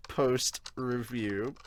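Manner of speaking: usual speaking voice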